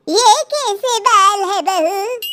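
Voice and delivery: grandma shocked at the dyed hair; shocked old grandma. A narrator's reading. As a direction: ask it aghast, slowly.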